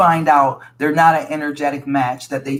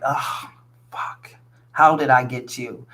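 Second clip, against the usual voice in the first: low-energy, hushed disgust; disgusted disdain; 4 semitones lower than usual. Acted. Listener looking at her partner with regret, muted disgust. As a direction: sigh it out with understated contempt. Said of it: "look at him with disgust, like, with disdain".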